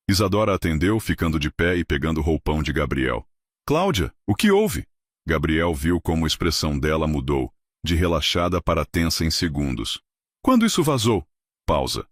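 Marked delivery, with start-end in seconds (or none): 3.6-4.8 high, alarmed phone greeting. Acted. Urgent late-night phone call. 10.4-11.2 high, louder alarmed question; learning the leak from her assistant, high alarm. Acted. Hears of a leak on phone.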